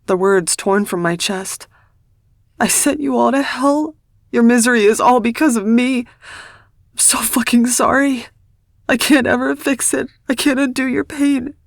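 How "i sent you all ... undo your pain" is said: hysterical apology. Acted. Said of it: "gripped by a fit of hysteria".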